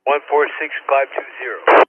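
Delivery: ordinary talk, nothing marked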